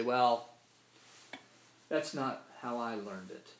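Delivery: slow, drawn-out Mississippi-style retort; Mississippi drawl. Retold or imitated. Preacher imitates a Mississippi listener dismissing scripture, drawled Mississippi retort. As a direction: mimic a Mississippi churchgoer brushing off a truth, slowly. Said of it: "That's how we say it in Mississippi".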